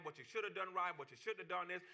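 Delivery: ordinary talk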